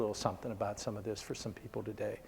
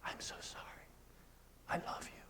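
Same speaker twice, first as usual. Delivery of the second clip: soft unvoiced whisper, much quieter; tearful whisper; 17 dB quieter, faster than usual. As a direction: whisper it through tears to a son. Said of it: "looks at him with tears".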